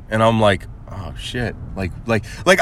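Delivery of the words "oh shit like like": low, hushed dread; retold under-the-breath oh-no; scared. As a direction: let it sink out softly. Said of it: "I was, like, scared".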